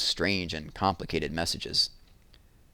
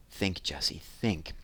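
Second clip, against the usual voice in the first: soft, low self-urging; whispered to herself; 3 semitones lower, 7 dB quieter, slower than usual. A narrator's reading. Jessie alone in the kitchen, softly urging herself. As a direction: urge yourself on under your breath. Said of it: "she whispered to herself".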